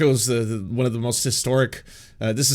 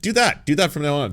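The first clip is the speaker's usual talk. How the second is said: ordinary delivery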